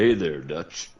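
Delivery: a hint of a rough cowboy greeting; Arthur Morgan voice. Shown as a demonstration. Other friend takes his turn at Arthur Morgan. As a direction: lightly growl a Texan hello. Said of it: "Let's hear it one more time".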